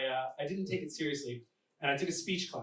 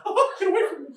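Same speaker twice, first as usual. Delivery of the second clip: high, loud panicked yelp; 13 dB louder than usual. Acted. Costumed Mickey recoiling from a drunk fan, panicked yelp. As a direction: shriek to fend someone off, far above your usual pitch. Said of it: "the dude in the suit kinda panicked".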